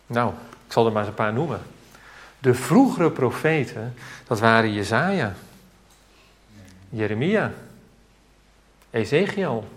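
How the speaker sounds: usual speaking voice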